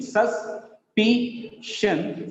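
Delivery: very slow "suspicion", sus, PI, shun; syllable by syllable. Shown as a demonstration. Teacher showing the stressed syllable, "suspicion" split apart. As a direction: break "suspicion" into three beats. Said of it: "second from last is stressed".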